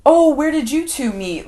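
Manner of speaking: high, loud, chirpy hostess; bright hostess voice. Retold or imitated. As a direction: ask it brightly and loudly. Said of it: "she prompts aloud".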